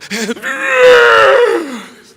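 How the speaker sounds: loud, high wailing cry acted out; mock screaming sob. Telling the audience how he broke down, a loud mock wail. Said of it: "a screaming, sobbing cry".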